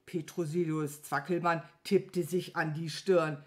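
usual speaking voice

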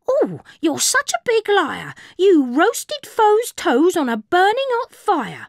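high squawky parrot voice; squawking parakeet. A narrator's reading. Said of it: "The parakeet squawked".

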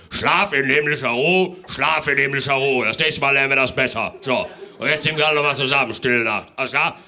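loud, scolding Father Christmas; a strict Santa telling off the parents; stern Santa. Acted.

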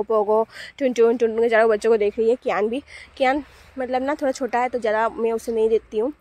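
ordinary talk, nothing marked

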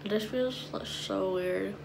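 thin film over teeth; slightly muffled teeth sounds; whitening strips on teeth. Real, not acted. Seen 0: Applied at -2.0; teeth covered, pressed on at 6.8; peeled off at 28.7.

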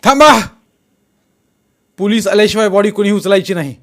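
high, very loud command to halt; full-force shout. A narrator's reading. Jaydeep stops men lifting the body, shouting at full force. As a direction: shout with all your strength to stop them. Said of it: "Jaydeep पुऱ्या शक्तीनिशी ओरडला".

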